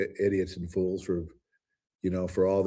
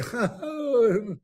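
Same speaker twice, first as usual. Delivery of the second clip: laugh. Real, not acted. After mock rant.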